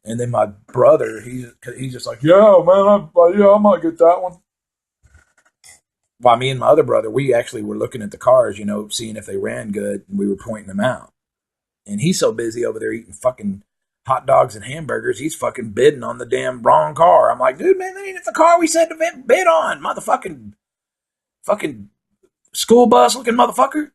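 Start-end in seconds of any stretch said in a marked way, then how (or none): 2.2-4.4 dopey brother. Retold or imitated. Brother answering the hecklers. 17.6-23.9 much higher shrieking scold at the brother; mock scolding yell. Scolding brother for wrong bid.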